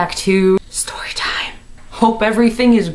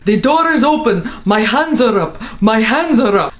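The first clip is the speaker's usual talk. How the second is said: hammed-up raid yell, higher; police raid shout; 3 semitones higher than usual. FBI agents heard during the dream raid, mock yelled. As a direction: call it out like an agent breaking in.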